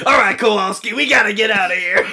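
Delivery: high, loud commanding cartoon penguin; penguin character impression. Contestant as penguin rallying a comrade, high loud character voice. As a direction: call the order out as a penguin leader.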